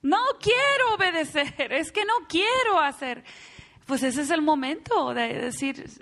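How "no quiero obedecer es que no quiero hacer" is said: put-on pitched-up child complaining; whiny child. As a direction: whine it like a stubborn kid. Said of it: "cuando el niño dice".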